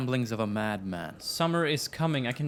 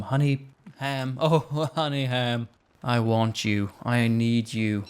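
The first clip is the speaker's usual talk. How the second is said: longing; 4 dB louder, slower than usual. Real, not acted. Craving honey ham.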